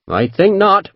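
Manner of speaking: high, cool Devonshire refusal; calm refusal. A narrator's reading. Captain refusing to leave, Devonshire accent. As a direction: refuse with easy confidence.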